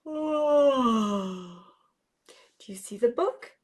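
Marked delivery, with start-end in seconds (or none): 0.2-1.5 long sleepy yawning sigh; big yawn. Shown as a demonstration. Showing the children a big sleepy yawn.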